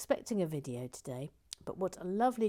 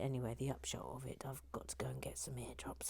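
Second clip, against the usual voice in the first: softer hushed summary; whispered wrap-up; 5 semitones lower, 8 dB quieter than usual. Real, not acted. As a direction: hush it down to finish. Wrapping up story in whisper.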